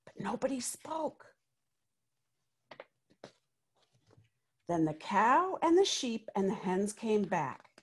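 put-on lively read-aloud voice for children; story narration. A narrator's reading. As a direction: tell it like a picture book to a class. Reads picture book aloud.

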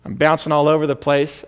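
ordinary talk

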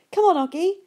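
high, loud, impatient coaxing; eager urging. Acted. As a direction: hurry him up, bursting with excitement. Sister urging him to open box.

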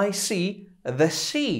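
"see" and "sea" sound the same; same sound for both words; see and sea alike. Shown as a demonstration. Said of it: "brzmi dokładnie tak samo jak morze".

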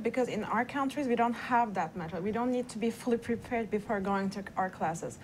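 usual speaking voice

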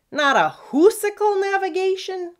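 high, loud blustering captain's bark. Acted. Pirate captain barks confused at his first mate. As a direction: bellow it like a puzzled pompous pirate. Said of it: "Captain Redbeard barked".

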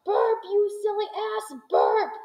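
hammed-up shouting upward; retold shout. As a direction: call up to him in mock alarm. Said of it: "I stood there shouting".

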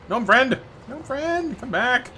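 high-pitched calling after someone; playful high plea; pleading call. Pretend. Calling after fleeing gnome.

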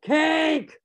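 loud, high, drawn-out bellow. A narrator's reading. Master calls from the house to be served, bellowed demand. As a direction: bellow an order through the house. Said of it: "Storch's voice bellowed from the house".

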